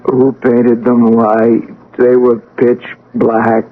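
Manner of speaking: just waking, lost in thought; slow, dazed confusion. Acted. Revived patient, confused.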